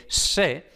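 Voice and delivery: "se" with a retracted Castilian s, nearly "she"; "se" as madrileños say it, s leaning towards sh; Madrid-style S. Shown as a demonstration. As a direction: raise the tongue tip for a hissy northern s in "se". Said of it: "los madrileños y toda esta gente del norte pues pronuncie".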